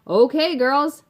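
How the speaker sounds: high, loud boss's call; drawn-out holler to the whole workforce; calling out orders. A narrator's reading. Father addresses his women workers at the factory, calling out loudly.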